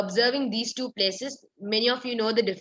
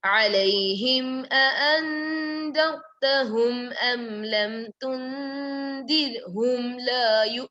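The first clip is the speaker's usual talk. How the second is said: Quran recitation; 4 semitones higher than usual. Acted. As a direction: recite the ayah in a measured melodic tone. Teacher recites the ayah.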